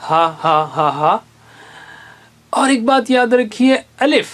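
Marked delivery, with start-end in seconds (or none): 0.1-1.2 ہا said again and again; teacher drilling the h letter; h sound drill. Shown as a demonstration. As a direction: repeat ہا evenly. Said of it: "تو کبھی double بن جاتا ہے".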